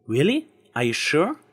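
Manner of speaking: lightly voiced Twilight, admiring; Twilight's voice. A narrator's reading.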